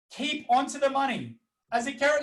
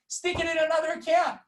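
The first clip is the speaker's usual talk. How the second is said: higher-pitched ranting insistence; 5 semitones higher than usual. Real, not acted. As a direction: push it like a heated rant. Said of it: "today's a bit of a rant".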